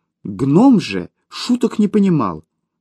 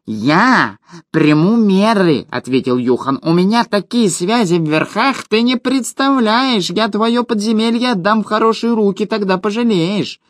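high, puffed-up gnome bluster; boastful gnome; 8 semitones higher than usual. Acted. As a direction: brag like a tiny self-important gnome. Gnome boasting of his connections.

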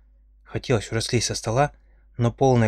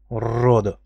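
growling; 4 dB louder, slower than usual. A narrator's reading. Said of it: "прорычал Динар".

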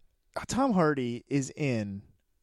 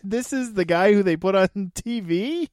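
ordinary talk

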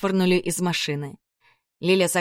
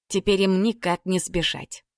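usual speaking voice

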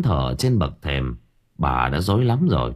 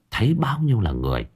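ordinary delivery

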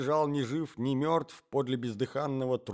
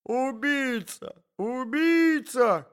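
high, long drawn-out scream; shrieking lady; 12 semitones higher, 5 dB louder, much slower than usual. A narrator's reading. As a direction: cry out high and very slow. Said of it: "кричала дама".

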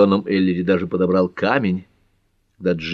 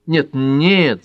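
higher, emphatic objection; protesting; 7 semitones higher, 4 dB louder than usual. A narrator's reading. Pat objecting to Ellery moving out, protesting. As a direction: protest at once, pitched up. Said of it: "запротестовала Пэт".

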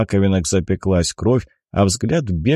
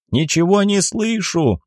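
high, slow, drawn-out call; raised pitch, words stretched, no real shouting; loud deafened speech; 10 semitones higher than usual. A narrator's reading. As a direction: call out as if your ears are ringing. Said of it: "громко произнёс он".

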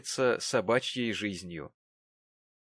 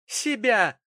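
high, loud scornful cry; indignant, loud echo; spiteful exclamation; 11 semitones higher, 7 dB louder than usual. A narrator's reading. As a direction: throw the word back with malice. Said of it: "воскликнула Черри, злобно усмехаясь".

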